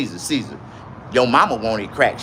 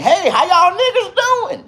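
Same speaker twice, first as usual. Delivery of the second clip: high, loud, laughing greeting; Chappelle milkman; 7 dB louder than usual. Retold or imitated. Retelling a Chappelle sketch in a loud high milkman voice. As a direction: burst in with a cheery high hello. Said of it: "he came in".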